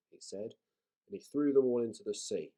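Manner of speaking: ordinary delivery, nothing marked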